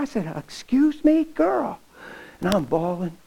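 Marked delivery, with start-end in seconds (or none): 0.4-1.8 sassy comeback. Retold or imitated. His sassy comeback to her.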